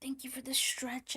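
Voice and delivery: soft breathy whisper. Real, not acted. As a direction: whisper it under your breath. Repeats the thanks whispered.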